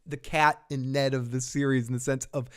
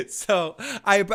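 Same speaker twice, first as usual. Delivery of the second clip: ordinary talk, nothing marked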